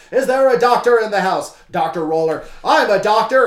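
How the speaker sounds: loud, high announcing; mock ringside call. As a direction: call out then answer. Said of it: "I wish that was a thing".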